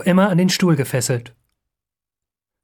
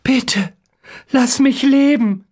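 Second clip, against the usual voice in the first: slow, high pleading; drawn-out plea with no strength left; weak last plea; 8 semitones higher, slower than usual. A narrator's reading. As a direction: beg slowly for your life. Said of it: "Ganz leise sagt er".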